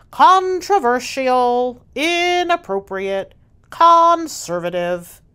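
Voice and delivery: slow, loud, pompous official voice; the mayor lecturing, slow and loud. Acted. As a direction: spell out each long word like a stuffy official.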